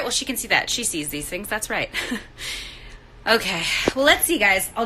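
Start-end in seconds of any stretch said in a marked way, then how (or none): none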